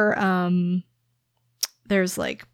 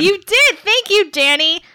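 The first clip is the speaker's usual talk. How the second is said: higher, louder, gleeful thanks; 9 semitones higher, 7 dB louder than usual. Real, not acted. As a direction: thank him with big glee. Mock-grateful after a setup.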